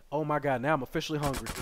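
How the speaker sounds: ordinary delivery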